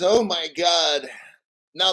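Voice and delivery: normal talking voice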